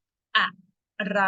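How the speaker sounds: teacher modelling อะไร syllable by syllable; model Thai word. Shown as a demonstration. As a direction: split อะ ... ไร into two clear beats. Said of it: "Repeat after me".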